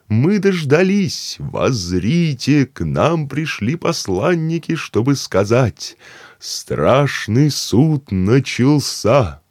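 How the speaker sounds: slow, high, loud proclaiming; solemn proclamation. Acted. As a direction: proclaim the Last Judgement grandly. Keeper proclaims judgement day.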